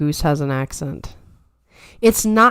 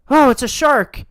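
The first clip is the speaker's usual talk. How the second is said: higher-pitched mock alarm; startled beachgoers; 9 semitones higher, 4 dB louder than usual. People coming upon a beached shark, mock alarm. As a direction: exclaim it like a startled onlooker, hammed-up. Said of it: "people come up and they're like".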